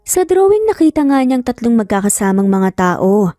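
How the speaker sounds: ordinary delivery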